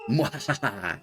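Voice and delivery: mock villain cackle; hammed-up evil laugh. Villain laugh after herb grab.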